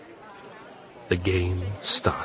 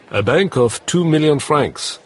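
croupier announcing; 7 semitones higher, 5 dB louder than usual. Acted.

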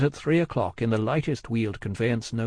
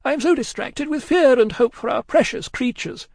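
high-pitched distraught woman's voice; wildly crying; 13 semitones higher, 5 dB louder than usual. A narrator's reading. Miss Pross to Cruncher, fearing for their friends, distraught. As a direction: play it distraught, pitched right up. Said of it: "wildly crying".

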